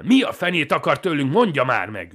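high, very loud demand; desperate full-voiced shouting; furious yell. A narrator's reading. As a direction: bellow it at him in exasperation. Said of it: "üvöltök rá Künstnerre".

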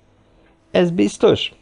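high, slow hopeful question; megkönnyebbült öröm. A narrator's reading. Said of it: "kérdezte megkönnyebbült örömmel". (megkönnyebbült, örömteli kérdés)

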